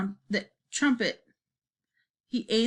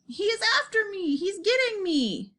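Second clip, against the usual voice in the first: high, wailing cries of terror; terrified giant, pitched way up; panicked scream; 11 semitones higher than usual. A narrator's reading. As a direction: wail it out like someone being chased. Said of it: "screamed the flesh lump eater".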